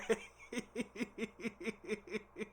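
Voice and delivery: high giggling in quick pulses; real laughter. Real, not acted. Still amused by their Instagram page, high giggles.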